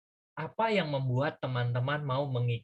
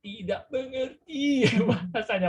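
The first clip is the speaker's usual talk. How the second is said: laughing; 10 semitones higher, 3 dB louder, slower than usual. Real, not acted. Confused by the Javanese word.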